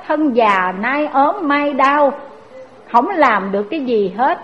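usual speaking voice

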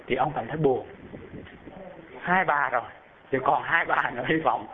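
ordinary talk, nothing marked

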